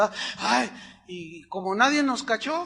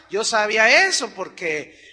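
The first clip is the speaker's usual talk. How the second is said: ordinary delivery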